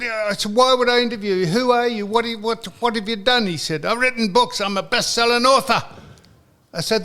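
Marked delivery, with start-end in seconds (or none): 0.5-3.5 high retold grilling; incredulous challenge. Retelling his reply to the interview demand, high and incredulous. 3.8-5.8 high, boastful mock Scottish accent; Gary Todd. Retelling the caller boasting of his books, high Scottish accent. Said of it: "he's Scottish".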